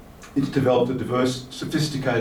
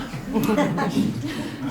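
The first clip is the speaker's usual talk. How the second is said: short laugh; 13 semitones higher than usual. Real, not acted. Laughs at reading comments.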